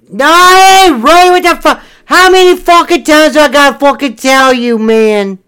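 loud, high, furious denial; angry yelling. Acted. Pastor character yelling at his drunk friend in anger. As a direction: yell it back at him, outraged. Heated comic argument, yelling back.

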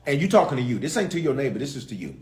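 ordinary talk, nothing marked

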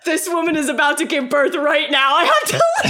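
shouting high through laughter; boyfriend's panicked excuse, laughed and shouted; laughing exit excuse. Retold or imitated. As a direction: blurt the escape line, cracking up. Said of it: "he's like".

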